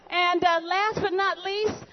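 usual speaking voice

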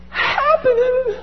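high, drawn-out squeak of disbelief; amazed squeal. Acted. Disciples report back to Jesus, squealing in amazement. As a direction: stretch it out in a high, thrilled whine. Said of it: "they come back and they're like".

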